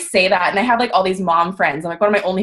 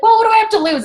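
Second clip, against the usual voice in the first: nothing-to-lose shrug; 12 semitones higher, 4 dB louder, much faster than usual. Retold or imitated. Her past shrugging-off attitude, voiced.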